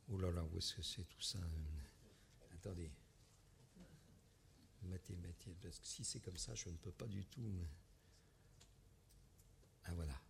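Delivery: very soft, low-key mumble while searching; absent-minded, barely audible self-talk; muttering to oneself. Real, not acted. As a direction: think aloud under your breath, hunting for a lost file. Searching for a file aloud.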